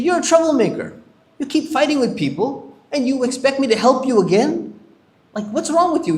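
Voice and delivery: high-pitched, exasperated telling-off; angry scolding. Retold or imitated. Musa scolds the Israelite man, angry and exasperated. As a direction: scold the troublemaker hard, voice jumping up. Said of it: "he got mad at the Israelite guy".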